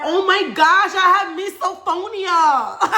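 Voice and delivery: high, loud, laughing discovery; laughing realization. Retold or imitated. Retelling reading about misophonia to sister, laughing excitement. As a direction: announce it with delighted shock.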